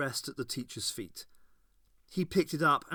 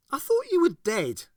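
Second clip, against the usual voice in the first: put-on high, loud young girl voice, bitter; Ace's girl voice, cutting and bitter; 8 dB louder than usual. A narrator's reading. Ace to Captain Sorin, bitter and high. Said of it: "she said bitterly".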